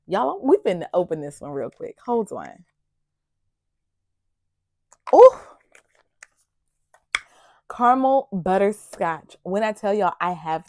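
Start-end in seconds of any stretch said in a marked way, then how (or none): none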